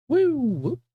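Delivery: sudden high whoop. Real, not acted. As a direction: let out a quick high whoop. Quick exclamation.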